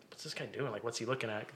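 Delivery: very soft, low imitation; muttered aside. Imitating a puzzled moviegoer eyeing the man beside her, muttered. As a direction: mutter a suspicious question to yourself. Neighbor's puzzled thought, retold.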